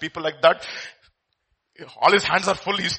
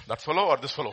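ordinary talk

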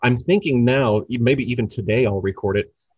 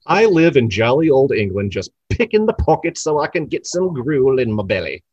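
bad British accent, lightly done; a hint of a Cockney beggar. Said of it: "Yet again, a horrible British accent".

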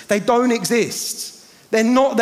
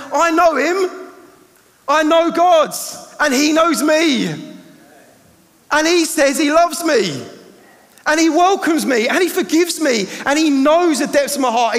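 loud, high, fervent declaration; preaching at full force; 8 semitones higher, 5 dB louder than usual. Acted. Preacher declaring his faith to the church, loud and fervent. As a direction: proclaim it with rising joy.

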